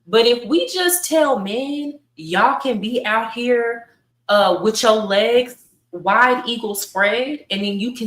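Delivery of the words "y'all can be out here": ordinary delivery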